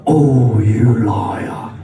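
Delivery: hammed-up jealous girlfriend; mock accusing girlfriend. Girlfriend accusing boyfriend of lying, loud and low.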